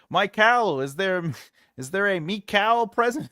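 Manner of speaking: loud, high teacher calling the register; roll call. Pretend. Mock teacher calling names in a classroom, loud and high. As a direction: call out the name like taking attendance.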